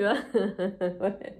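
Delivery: ordinary talk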